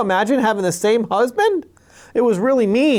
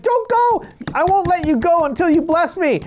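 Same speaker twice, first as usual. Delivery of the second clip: much higher, desperate plea; pleading Jacob; 10 semitones higher than usual. Retold or imitated. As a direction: beg him not to leave. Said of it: "and he's like".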